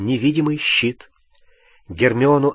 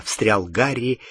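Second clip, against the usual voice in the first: ordinary delivery, nothing marked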